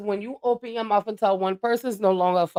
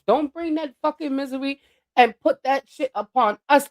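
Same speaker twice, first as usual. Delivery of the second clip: normal talking voice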